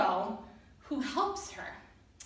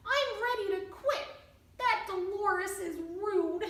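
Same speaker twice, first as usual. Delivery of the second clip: higher, sulky mouse voice; glum mouse; 7 semitones higher than usual. Acted. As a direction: mope it out as the hurt little mouse. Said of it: "in a terrible mood".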